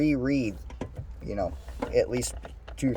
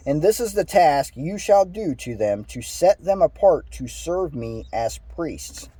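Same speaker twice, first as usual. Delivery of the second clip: higher, measured read-aloud voice; scripture reading; 5 semitones higher than usual. Acted. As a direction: read the verse out with lilting emphasis. Reads scripture aloud to listeners.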